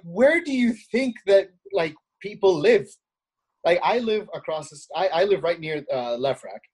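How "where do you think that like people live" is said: higher-pitched, exasperated disbelief; incredulous retort. Retold or imitated. Retorting to the tired old voter ID argument, incredulous. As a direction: ask it as a baffled challenge.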